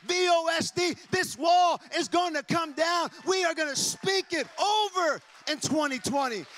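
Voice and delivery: loud, high declaring shout; full-force preaching. Acted. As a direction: proclaim it like a prophecy over the people. Declaring buildings over churches.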